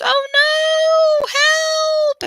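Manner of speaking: high hammed-up shout for help; mock cry for help. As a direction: play trapped and call for rescue. Mock trapped in computer.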